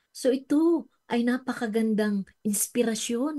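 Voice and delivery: ordinary talk, nothing marked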